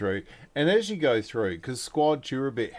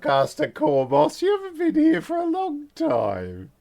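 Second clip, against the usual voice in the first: high, loud, delighted squeal; gushing Alicia; 8 dB louder than usual. Acted. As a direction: squeal with delight at an old acquaintance. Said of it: "She goes".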